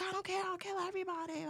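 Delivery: very high, softened mock yell; imitating angry driver. Driver answering the screaming lady, high-pitched mock rant. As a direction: squeal out the driver's threat without real volume. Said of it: "And he's like".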